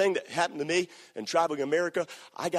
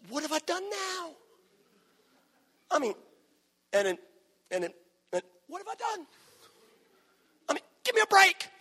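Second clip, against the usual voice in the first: much higher, exasperated protest; indignant David; faster than usual. Acted. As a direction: plead innocence, fed up.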